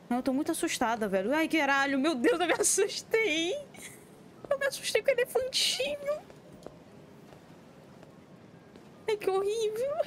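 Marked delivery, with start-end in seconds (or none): 1.3-3.5 louder, higher and slow right after a scare; real fright. Real, not acted. Gamer just jump-scared, slow frightened cry. 4.5-10.0 much higher, tight scared voice; squeaky fright. Real, not acted. Gamer scared by the little elephant, high squeaky fright.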